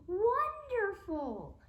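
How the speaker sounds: slow, high, loud wonderful; gleeful shout. Acted. Professor shouting with glee at the new name. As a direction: stretch it out in villainous glee.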